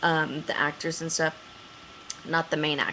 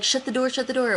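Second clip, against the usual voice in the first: higher, pressing plea; urgent plea; 7 semitones higher, faster than usual. Retold or imitated. Begging the crew guy to shut the trailer door, urgent. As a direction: beg them to close it now.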